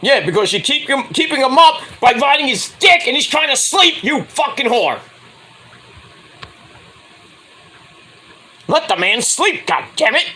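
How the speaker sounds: high hammed-up scolding of a student; mock angry rant. Teacher rant retold as a quote.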